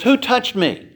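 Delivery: high, urgent call into the crowd; Jesus asking who touched him. Acted. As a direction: call out sharply, searching.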